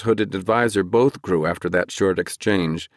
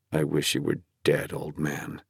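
low, quiet resentful thought; childish anger; 4 semitones lower, 6 dB quieter than usual. A narrator's reading. Flagg silently wishes the old king dead, with quiet anger. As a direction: seethe softly in a lowered voice. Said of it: "Flagg thought with childish anger".

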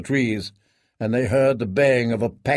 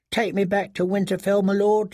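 begged; 7 semitones higher than usual. A narrator's reading. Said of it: "she begged".

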